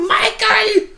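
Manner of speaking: high shouted name; mock call. Doctor calling for Michael, high mock shout. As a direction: call out across the scene.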